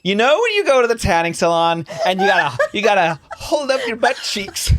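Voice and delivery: high-pitched imitation of someone explaining; mimicked explanation. Co-host imitating partner explaining booty moons, high mimicry. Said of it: "this is how you explained it".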